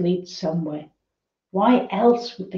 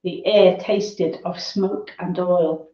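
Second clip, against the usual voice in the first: plain reading voice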